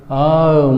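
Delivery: आम with long aa, no r; teacher demo of arm; silent r. Shown as a demonstration. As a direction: say आम as "aam", the r silent. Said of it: "उसके बाद एआरएम".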